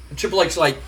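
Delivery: usual speaking voice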